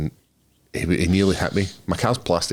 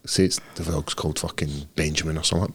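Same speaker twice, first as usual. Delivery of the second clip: normal talking voice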